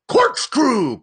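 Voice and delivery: high, loud triumphant cry; cried out. A narrator's reading. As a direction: blurt it out like the answer just hit you. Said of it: "cried Ahab".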